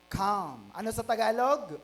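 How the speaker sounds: normal talking voice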